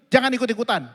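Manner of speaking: loud angry command; loud retold scolding; angry shout. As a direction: cut the kid off hard. Father snaps at second child.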